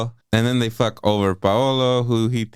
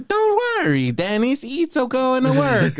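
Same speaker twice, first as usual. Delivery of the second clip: high, insistent cartoon Italian accent; hammed-up Italian plumber impression; fake Italian plumber. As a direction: reassure them in a silly Italian voice. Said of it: "he's just doing like".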